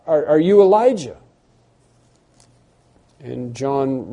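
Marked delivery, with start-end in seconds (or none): 0.1-1.2 higher, louder pressing question; urgent question. Retold or imitated. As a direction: demand an answer urgently.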